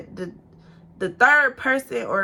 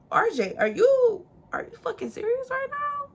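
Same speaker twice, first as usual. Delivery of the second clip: incredulous; 14 semitones higher than usual. Retold or imitated. To RJ at four in the morning, stunned and incredulous. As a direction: stretch the name, pitched up in disbelief.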